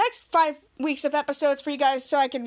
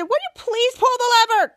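high, loud pleading question; hammed-up impatient plea; impatient demand; 8 semitones higher, 5 dB louder than usual. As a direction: beg with exasperation, pitched up.

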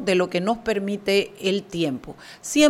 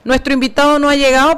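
ordinary delivery

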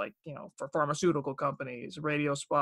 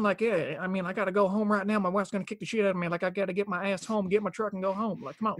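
slightly raised, quick-fire Southern rant; mock country-boy grumble in a Southern accent; fast Southern accent; 4 semitones higher than usual. Shown as a demonstration. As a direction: rattle it off like an impatient Southerner. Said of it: "some of 'em are faster".

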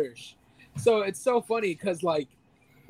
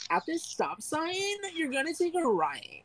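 put-on blonde girl; 9 semitones higher, 6 dB quieter than usual. Giving stop-sign directions as an imitated blonde girl. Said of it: "a blonde girl being like".

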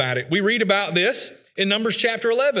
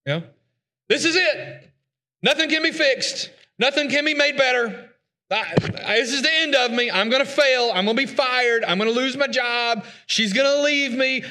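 despairing cynic; 5 semitones higher than usual. Retold or imitated. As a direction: play the doom-saying pessimist, rising higher. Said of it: "The cynic is the individual who's like".

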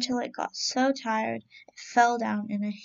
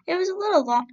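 eager rabbit; 7 semitones higher, 5 dB louder, faster than usual. Acted. Rabbit answers the cat.

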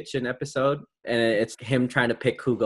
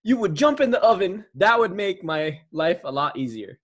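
higher mock British chef, easing toward the end; Gordon Ramsay; 6 semitones higher than usual. Host playing an insulting chef for the quiz, attempted impression. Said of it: "I need to personify Gordon".

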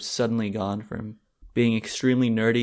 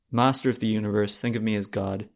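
normal talking voice

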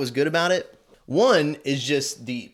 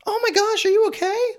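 put-on very high, louder little girl's worried voice; Cindy Lou; 6 dB louder than usual. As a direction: call out like a small worried girl. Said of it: "Cindy Lou goes".

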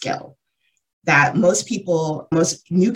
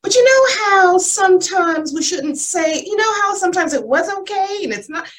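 Julia gently explaining. Retold or imitated. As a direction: coax them like a patient friend. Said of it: "Julia was like".

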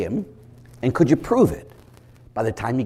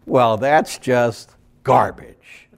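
normal talking voice

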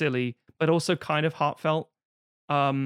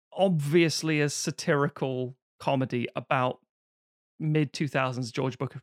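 normal talking voice

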